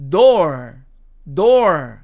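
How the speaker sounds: DOOR with the r fully sounded; American door variant. Shown as a demonstration. Teacher showing the other American way, DOOR with r. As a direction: finish DOOR on a clear American r. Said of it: "R 발음을 정확히 해주는데".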